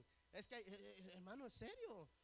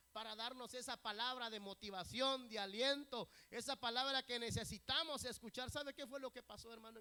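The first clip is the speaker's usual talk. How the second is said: loud, driving sermon voice; impassioned preaching; 4 semitones higher, 13 dB louder than usual. Real, not acted. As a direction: proclaim it to the congregation. Preaches at full force. (predicación apasionada en voz alta)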